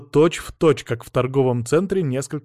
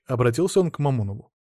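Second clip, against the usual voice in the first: ordinary delivery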